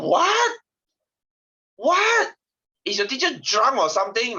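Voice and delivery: high, loud incredulous "What?"; shocked disbelief. Retold or imitated. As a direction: shriek it in disbelief, then scoff. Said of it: "I was like".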